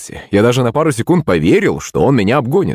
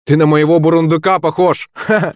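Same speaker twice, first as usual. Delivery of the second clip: high-pitched mocking cry with laugh; exclaimed taunt; 6 semitones higher than usual. A narrator's reading. Pupil Roma mocks spotted classmate, exclaimed taunt. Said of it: "вскрикнул один из учеников".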